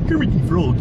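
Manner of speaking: a hint of a cheerful frog puppet; Kermit the Frog. Shown as a demonstration. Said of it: "well, that, I just did".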